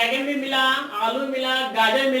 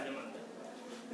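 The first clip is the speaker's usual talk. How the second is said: slow unvoiced whisper; 9 semitones lower, 21 dB quieter, much slower than usual. Real, not acted. Teacher whispering to students to pay attention, slow whisper. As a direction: breathe it to the class, slowly.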